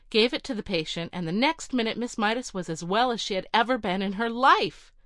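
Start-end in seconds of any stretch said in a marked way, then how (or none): none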